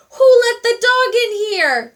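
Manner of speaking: loud, high indignant demand; hotel manager angrily demanding; angry demand. A narrator's reading. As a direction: demand an answer furiously. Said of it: "demanded the manager".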